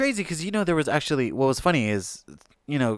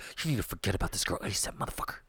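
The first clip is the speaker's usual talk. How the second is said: hushed whispered advice; 3 semitones lower, 11 dB quieter, faster than usual. Retold or imitated. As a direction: lean in and whisper it urgently. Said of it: "Yeah, I was like".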